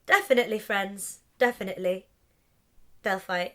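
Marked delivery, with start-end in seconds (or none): none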